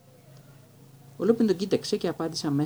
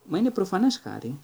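taken aback; 6 semitones higher than usual. A narrator's reading. Teacher startled by his pupil's question, surprised. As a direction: react as if the answer were obvious. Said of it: "απάντησε ξαφνιασμένος".

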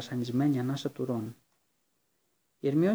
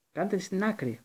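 higher, urgent call to get clear; shouted warning; 6 semitones higher than usual. A narrator's reading. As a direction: shout at friends to step aside. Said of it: "φώναξε στο Ρον και στην Ερμιόνη".

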